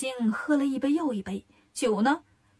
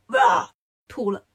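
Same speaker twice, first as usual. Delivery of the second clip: retching; 2 semitones lower, 4 dB louder, slower than usual. A narrator's reading. Lion demon vomiting.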